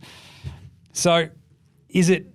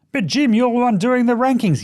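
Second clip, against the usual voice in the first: a lightly put-on higher voice of a cheeky listener; imagined heckler; 8 semitones higher, 3 dB louder than usual. A listener teasing the host about his own rankings, mock heckle. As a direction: needle the host from the audience.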